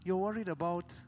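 soft, unhurried pastor impression; imitating a hushed, measured preacher; so quiet. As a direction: speak softly and slowly, without animation. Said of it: "He just said it so quietly".

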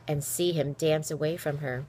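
plain reading voice

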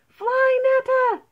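very high, loud squeal of alarm; mouse squeak. Acted. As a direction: squeak it urgently like frightened mice. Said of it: "squeaked the mice".

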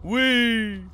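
loud, high squeal of fun; gleeful whee. Real, not acted. Streamer squealing with fun at his game. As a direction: let out a joyful whee. Playful cry during gameplay.

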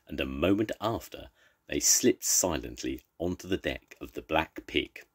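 ordinary delivery, nothing marked

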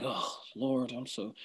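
low, soft, feeble sigh; hushed, hammed-up despair; mock doubting prayer. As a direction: play a faint-hearted believer sighing to God. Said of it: "So if you're like".

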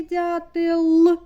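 "дятел" with a long, loud final Л; виділений останній звук. Shown as a demonstration. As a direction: hold the last Л of "дятел". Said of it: "останній звук в слові". (протяжне, гучне Л в кінці слова "дятел")

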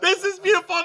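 happy crying, half-joking; mock teary. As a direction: pretend to choke up with joy. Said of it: "And I was like".

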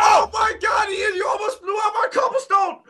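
very high, squealing cry; panicked outcry. Real, not acted. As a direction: shriek it at your teammate in panic. Creeper nearly blew up their work.

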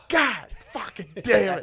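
high, drawn-out mock curse; imitated dad outburst. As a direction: curse at the TV in slow exasperation.

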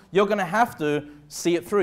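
ordinary delivery

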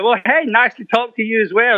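high, laughing mock politeness; mock polite. Greeting an old bandmate on the phone, mock polite. Sarcastic reply to Gary.

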